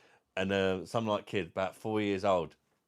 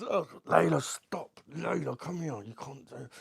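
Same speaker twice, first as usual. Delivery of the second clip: higher, hurried telling-off; parent scolding; 8 semitones higher, slower than usual. Retold or imitated. Parent telling Layla to stop, raised flustered voice. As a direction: call the child back in a fluster. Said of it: "And she's going".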